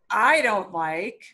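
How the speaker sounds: "I" punched, slow and drawn out; stress on I. Shown as a demonstration. Spanish teacher showing English emphasis, "I" stressed. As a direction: punch the word "I", stretching it before "don't like". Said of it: "we punch the word I".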